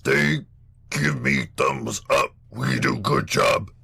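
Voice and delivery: slow demon voice, word by word; demon calling out. Acted. Demon reporting to Malachi's side, slow monster voice. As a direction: lay out each word heavily, like a foreign creature.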